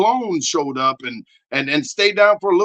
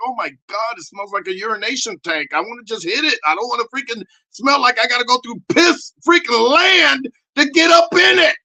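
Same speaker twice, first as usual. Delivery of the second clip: disgusted rant; 6 semitones higher, 3 dB louder than usual. Retold or imitated. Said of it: "it's like".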